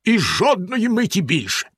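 a menacing husband's firm, clipped refusal; Bluebeard. A narrator's reading. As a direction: shut her down with no mercy. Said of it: "відповів синя борода".

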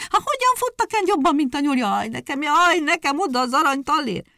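high, loud wailing wife. A narrator's reading. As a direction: keep lamenting the lost coin. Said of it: "óbégatott az asszony".

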